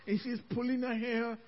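ordinary talk, nothing marked

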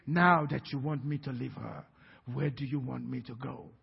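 hint of a low voice from the girl; low demon voice. Preacher quoting the demon asking where to go, slightly low. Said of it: "this was kind of low voice".

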